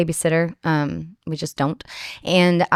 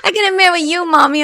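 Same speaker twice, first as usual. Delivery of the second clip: put-on little boy; 10 semitones higher, 4 dB louder than usual.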